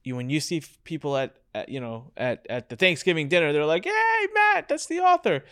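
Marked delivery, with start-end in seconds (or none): 3.8-5.4 high, bright mock greeting from relatives; excited greeting. Relative at Thanksgiving hails the author, high and excited. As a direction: pitch it way up like an eager relative.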